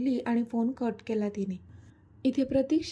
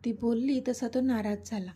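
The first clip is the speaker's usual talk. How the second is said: usual speaking voice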